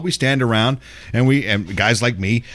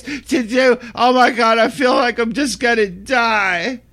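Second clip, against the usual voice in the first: high, whining mock complaint; mock whiny complainer; 10 semitones higher than usual. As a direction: imitate a dramatic whiner, pitched up. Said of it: "bitch about how hard it is".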